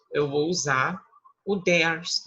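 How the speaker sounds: usual speaking voice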